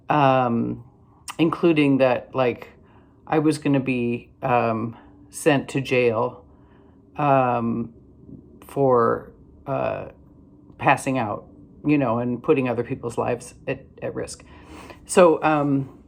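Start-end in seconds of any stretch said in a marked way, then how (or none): none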